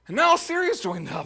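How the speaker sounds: and NOW, as if this is the last straw; exasperated outburst. Retold or imitated. Preacher mimicking the psalmist's last-straw cry. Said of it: "that's exactly how he says it".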